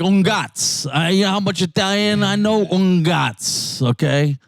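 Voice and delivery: high, mocking put-on Italian voice, slowed on un gats; mock Italian grandpa. Friend mocking a fake Italian, high put-on voice. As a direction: lampoon the guy who knows two words of Italian. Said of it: "He was like".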